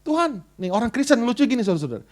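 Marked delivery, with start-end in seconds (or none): 0.0-0.4 high whiny call to the Lord; a hint of a moaning believer; complaining prayer. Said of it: "kita ngomel sama Tuhan".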